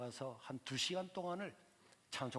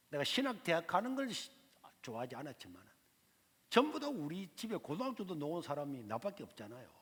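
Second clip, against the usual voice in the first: ordinary delivery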